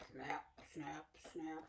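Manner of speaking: soft hushed snaps; whispered snap. Pretend. Teacher whispering alligator snaps to children. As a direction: breathe the snaps quietly.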